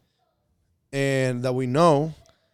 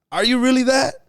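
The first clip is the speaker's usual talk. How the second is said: incredulous; 11 semitones higher, 5 dB louder than usual. Real, not acted. Incredulous challenge to arrogant person.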